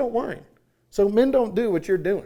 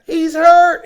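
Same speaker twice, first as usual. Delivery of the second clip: high, loud frantic cry; hammed-up panic of the party dad; panicked yell; 13 semitones higher, 14 dB louder than usual. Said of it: "is yelling".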